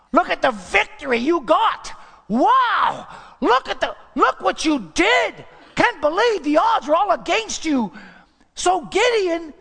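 high, loud crowd adulation, acted out; gushing admiration. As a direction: hail a hero with hammed-up amazement. Acting out crowd hailing Gideon.

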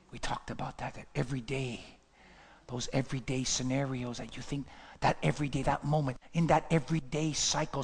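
low, very soft, near whisper with long pauses; hushed, grave preaching. Real, not acted. Preacher mourning a pastor's lost moment, hushed and grave. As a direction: let it sink in almost under the breath.

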